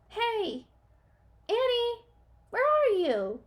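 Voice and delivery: high, loud calling into the hallway; loud searching call. A narrator's reading. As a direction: shout down an empty hall. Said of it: "he said".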